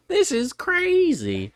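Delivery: very high, drawn-out squeal; mock overwhelmed squeal. Podcaster acting out a torn movie character, hammed-up squeal. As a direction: stretch it out high and loud, hammed up.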